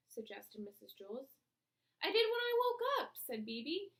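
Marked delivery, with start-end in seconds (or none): 2.0-3.2 high, loud insistent protest; insistent child. A narrator's reading. BB insists to her teacher she checked. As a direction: insist you already did.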